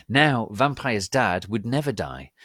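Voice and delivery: plain reading voice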